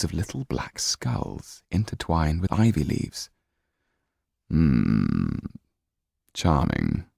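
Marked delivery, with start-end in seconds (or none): 4.5-7.0 very slow, low and silky; sly Count. Acted. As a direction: savour each word, amused. Count eyes Sido.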